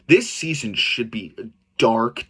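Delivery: ordinary delivery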